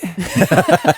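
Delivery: a burst of high laughter; bright burst of laughter. Real, not acted. Laughing at Stokes' question.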